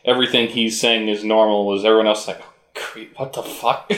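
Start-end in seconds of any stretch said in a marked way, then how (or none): 2.4-4.0 whispered then breathy disgusted aside; mock disgust. Podcast host mimics disgusted bystanders, whispered disgust. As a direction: recoil like you've met a creep. Mocking everyone else's reaction.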